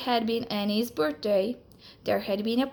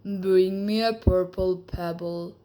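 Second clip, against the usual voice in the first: lower, slow and solemn lizard voice; a magic lizard setting its condition; 3 semitones lower, 3 dB louder than usual. Acted. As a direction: speak like a wise old magic lizard.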